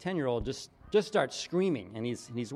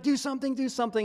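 pleading, much higher; wife's mock frantic plea, much higher; urgent plea; 10 semitones higher, faster than usual. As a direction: beg for help in a rush. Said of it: "Amy's like".

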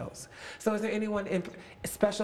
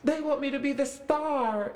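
much higher, thrilled imitation of her younger self; mock excitement; 9 semitones higher than usual. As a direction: gush like you just got the lead role. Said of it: "my dumb self was like".

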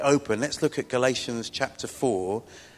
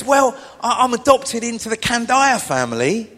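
put-on excited child; 10 semitones higher, 10 dB louder than usual. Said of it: "And she says".

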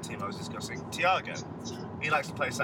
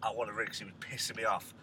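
ordinary delivery

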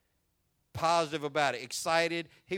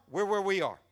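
ordinary talk

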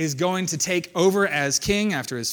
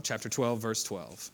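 softer, lower, self-correcting under the breath; quiet correction; 5 semitones lower, 10 dB quieter than usual. Real, not acted. Preacher quietly corrects the verse number for the congregation. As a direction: drop the voice and fix the reference quietly. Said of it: "Sorry, did I say this is verse twelve?".

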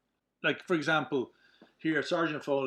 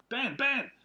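high, loud call after someone walking away; 11 semitones higher, 3 dB louder than usual. Acted. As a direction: shout his name to stop him. Donna calls after Ben leaving.

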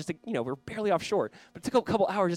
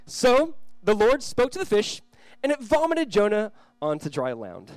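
slow, raised reading with "vomited" stressed; dramatic reading; 5 semitones higher, 5 dB louder, slower than usual. Acted. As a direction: lean into "vomited" as you read. Scripture reading.

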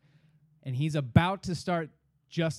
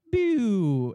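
loud, higher "boo" sound effect; imitated deflating noise; 5 semitones higher, 7 dB louder than usual. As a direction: imitate something drooping with a noise. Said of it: "and then goes".

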